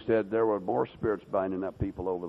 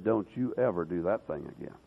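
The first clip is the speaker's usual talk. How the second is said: a hint of Satan, lower and softer; menacing warning; 5 semitones lower, 6 dB quieter than usual. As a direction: growl the threat quietly. Satan threatens the preacher.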